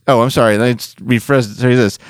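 usual speaking voice

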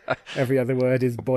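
real amused laugh running through it. Real, not acted. Joking with co-host about fake Welsh, laughing.

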